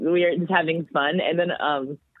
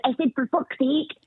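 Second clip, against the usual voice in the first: put-on Scottish toddler; 9 semitones higher than usual. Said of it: "she said".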